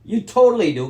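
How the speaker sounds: loud, high retort; angry shout. Real, not acted. Son shouting down his mother's denial. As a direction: cut her off in anger.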